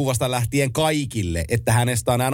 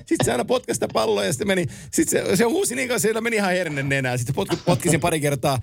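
ordinary delivery

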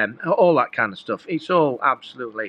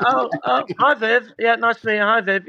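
higher, bright and oblivious hellos; clueless polite greeting; 10 semitones higher, 3 dB louder than usual. Retold or imitated. To Viv at the awards, an oblivious higher chirpy hello. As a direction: play it unaware who she is. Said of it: "and I'm like".

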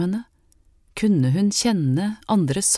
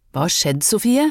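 calling out; 3 semitones higher, 3 dB louder than usual. A narrator's reading. Hanna calling to the crying Sofie, worried and louder. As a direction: call out louder across the street, worried. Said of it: "ropte Hanna".

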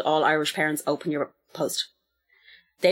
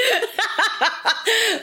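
high burst of laughter. Real, not acted. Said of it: "laughing at mum".

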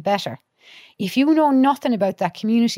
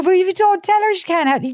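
higher and louder, pressing him; urgent raised voice; 10 semitones higher, 5 dB louder than usual. Retold or imitated. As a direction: push him to open up, voice pitched up high. Urging the young fella to talk.

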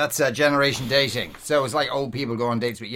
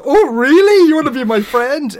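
much higher and louder, starstruck gushing; gushing dupe; 12 semitones higher, 9 dB louder than usual. Retold or imitated. Rich New Yorkers fawning over the fake heiress, high gushing squeal. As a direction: squeal it like a flattered socialite. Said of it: "and they're going".

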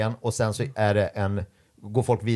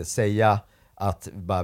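ordinary talk, nothing marked

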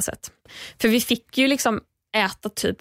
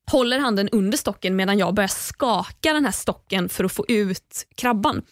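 ordinary talk

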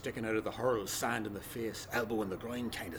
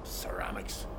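whispered aside; 7 semitones lower, 4 dB quieter, slower than usual. Acted. Muttered aside mid-speech.

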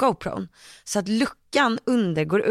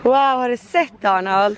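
mock amazed; 4 semitones higher, 5 dB louder, slower than usual. Acting surprised to her son.

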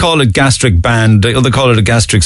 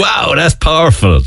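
slow, raised "wow" of admiration; impressed; 6 semitones higher than usual. Retold or imitated. Reader reacting to a powerful paragraph, impressed. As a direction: stretch it out, struck by the words.

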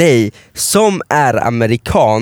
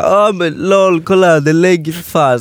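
mock excited yell, raised pitch; retold yelling; 4 semitones higher, slower than usual. Yelling to friends about Leggy, mock excited shout. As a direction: imitate calling friends over, half-shouted. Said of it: "skriker jag och så här".

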